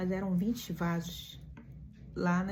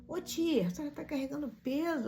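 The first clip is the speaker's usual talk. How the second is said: higher, lightly voiced street boy; playful young boy, higher pitched; street kid; 8 semitones higher than usual. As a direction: imitate the kid's friendly way. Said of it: "aquele jeitinho dele".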